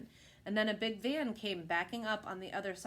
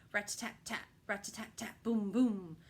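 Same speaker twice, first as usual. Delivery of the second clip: drum sounds. A narrator's reading. Imitating marching band drums.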